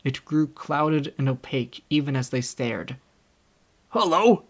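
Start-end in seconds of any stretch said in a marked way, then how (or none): 3.9-4.4 high, loud exclamation; surprised cry. A narrator's reading. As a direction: call out in surprise. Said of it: "cried the constable".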